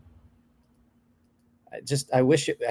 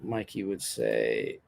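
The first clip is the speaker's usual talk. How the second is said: mock deep voice; 12 semitones lower, 12 dB quieter, slower than usual. Voicing a friend's catchphrase.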